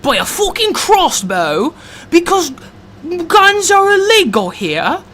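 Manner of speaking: high-pitched, hammed-up Aussie accent; attempted Australian accent. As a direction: put on an exaggerated Australian accent, pitched well up. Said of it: "I'm working on my Australian accent".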